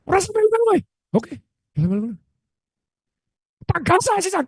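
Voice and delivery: high mock shooing; a hint of an angry partner; lightly voiced angry host. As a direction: brush off the peacemaker in a high put-on voice. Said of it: "Diusir, woy".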